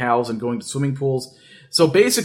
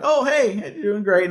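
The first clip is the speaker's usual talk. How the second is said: mock polite reply; 7 semitones higher than usual. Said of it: "we're like".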